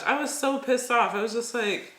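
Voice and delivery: ordinary talk, nothing marked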